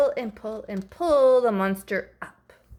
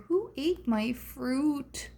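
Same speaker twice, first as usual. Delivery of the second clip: sad monkey; 5 semitones higher, 6 dB quieter, slower than usual. Acted. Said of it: "there's a sad monkey".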